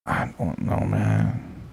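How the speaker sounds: soft unvoiced give-up; whispered. Real, not acted. Streamer whispering while stuck searching characters. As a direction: breathe it out, defeated.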